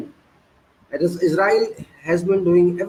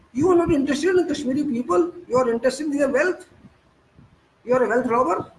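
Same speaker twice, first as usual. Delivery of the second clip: raised, higher-pitched scolding of the government; angry rebuke; 7 semitones higher than usual. Real, not acted. Speaker scolding the government over Kashmir, raised indignant voice. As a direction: demand answers, voice up high.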